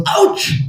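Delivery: sudden high yelp of pain; mock cry of pain; high ouch. Lord cries out in story.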